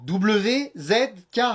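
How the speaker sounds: letters spaced far apart; slow correction. Acted. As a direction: spell it out slowly and carefully. Corrige en dictant lentement. (épeler très lentement)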